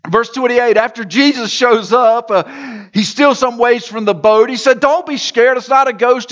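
full-voiced sermon narration; preaching. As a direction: preach the story out to the hall. Sermon storytelling at full force.

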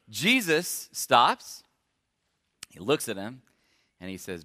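ordinary talk

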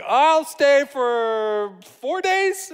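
put-on high, slow, earnest child voice; little boy voice. Four-year-old son offering his parents a visit, in a high, slow child voice. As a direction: say it like a proud small boy bargaining. Son's voice in retold story.

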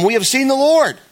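high, loud joyful news; excited announcement. Acted. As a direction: tell him you've seen the Lord, thrilled. Disciples excitedly telling Thomas.

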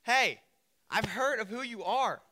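loud, high, forthright greeting; straight-up, direct. Acted. Boaz walks straight up to Ruth, direct and loud. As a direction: come straight out with it, boldly. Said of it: "He straight up is like".

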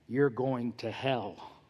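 low, very soft, slow murmur; confidential aside. Retold or imitated. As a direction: lean in and quietly confide it, drawn out. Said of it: "He cupped his hand".

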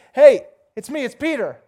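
acted shout to be let in; calling out. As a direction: call through a shut door. Acted Peter calling at door.

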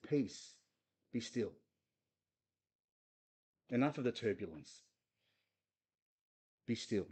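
soft, calming command. Acted. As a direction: hush the sea gently. Jesus calming the sea.

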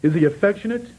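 high, loud, ringing preacher's voice; impassioned sermon delivery quoting Jesus's promise; full-force preaching. Real, not acted. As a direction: preach it out, building with fervour.

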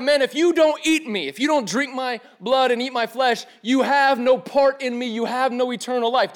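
high, loud preacher's proclamation; acted emphatic insistence. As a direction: drive each condition home forcefully. Acts Jesus insisting to baffled crowd.

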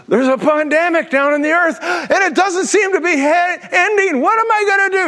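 high, frantic voice of a worried God; mock panicking God. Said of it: "God is sitting up in heaven and going".

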